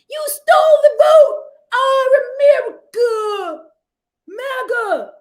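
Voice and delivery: wailing high shouted rioter chant; mock rioter yell. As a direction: scream it like an outraged rioter. Mocking January sixth rioters.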